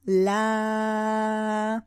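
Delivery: la with the vowel drawn out; open la held long. Shown as a demonstration. Teacher showing la is also open. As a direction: hold la on and on. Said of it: "la is exactly the same".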